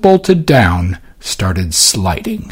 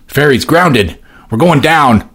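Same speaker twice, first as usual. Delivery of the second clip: yell; 4 semitones higher than usual. A narrator's reading. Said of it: "he yelled".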